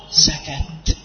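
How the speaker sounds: سكت whispered on the breath; whispered mnemonic word; whispered demo. Shown as a demonstration. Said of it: "من أحرف الهمس".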